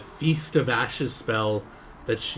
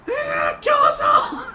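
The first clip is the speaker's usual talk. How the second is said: panicked goblins; 2 semitones lower, 10 dB louder than usual. Acted. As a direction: shriek it out like a terrified goblin. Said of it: "several goblins start saying".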